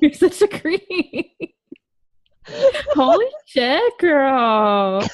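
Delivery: high, drawn-out amused shock; teasing disbelief. Real, not acted. Friend teasing another over a sent picture, mock-scandalized.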